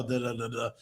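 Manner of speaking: very low, soft smooth-talker murmur; smooth voice imitation. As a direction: drop deep and quiet, crooning nonsense syllables. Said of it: "come in with that smooth".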